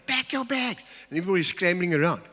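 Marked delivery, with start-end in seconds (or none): 0.1-0.8 higher, pitched-up yell of the command; mock shouting parent. Shown as a demonstration. Parent shouting at the kids to pack, high yell. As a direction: play the fed-up parent finally shouting. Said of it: "why do I always have to shout?".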